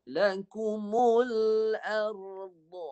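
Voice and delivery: Lakumul arda drawn out slowly, dad from the tongue sides; slow recitation with dad. Shown as a demonstration. Teacher models dad for the student. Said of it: "Cuba baca".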